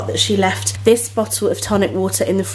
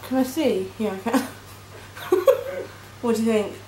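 face covered by wet mask; through a sheet mask; 3 semitones higher than usual. Real, not acted. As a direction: lips stiff under mask. Seen 1: Wet tiger-print sheet mask covers her face while she speaks; hands press cheeks.

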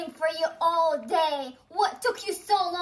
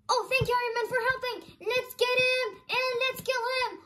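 higher, eager buddy voice; hero voice; 6 semitones higher than usual. Pretend. Hero thanking Iron Man before the attack, higher and eager. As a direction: cheer your partner into the fight.

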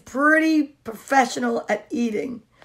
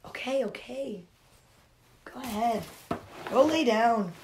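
usual speaking voice

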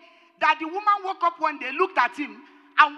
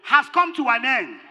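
loud, slow, ringing proclamation; thundering sermon declaration; preaching at full force; 3 semitones higher, 6 dB louder than usual. Acted. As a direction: announce it like a prophet to the hall. Preacher proclaims an end to suffering.

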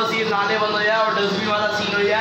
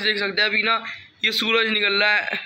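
ordinary delivery

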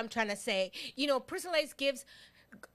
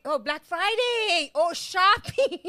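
slow, drawn-out "oh" of understanding; dawning realization; 6 semitones higher, 5 dB louder, much slower than usual. Real, not acted. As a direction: stretch it out as it finally clicks.

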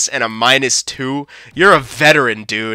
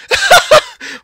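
disbelieving laugh; 3 dB louder than usual. Real, not acted.

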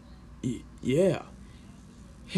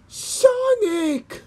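high, far-off call of a name. Acted. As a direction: call him from across the field, pitched up. Calling out to Sonic.

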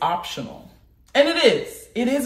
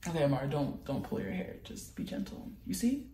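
quiet self-talk; 4 semitones lower, 12 dB quieter than usual. Real, not acted. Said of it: "talking to herself while combing".

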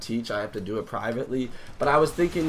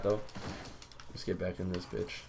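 ordinary talk

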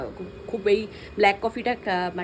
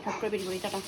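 softer, dropped-down afterthought; tossed off under the breath; soft quick aside; 2 semitones lower, 6 dB quieter, faster than usual. Real, not acted. Quick repeat of sign-off. (নিচু গলায় তাড়াতাড়ি বলা)

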